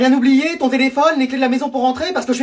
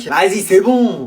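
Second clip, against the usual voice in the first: dédain traînant; 3 semitones lower, much slower than usual. Acted. Refus méprisant, traînant.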